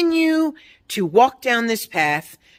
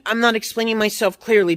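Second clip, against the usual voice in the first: normal talking voice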